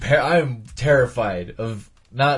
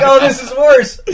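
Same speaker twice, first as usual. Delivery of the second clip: high, loud, laughing whine; a hammed-up cry of dismay; mock dismayed wail; 11 semitones higher, 8 dB louder than usual. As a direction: wail it in comic despair. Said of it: "he's all like".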